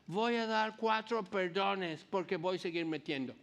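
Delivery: slowly, like an American fumbling Spanish; mock American accent in Spanish. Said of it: "y con mucho acento".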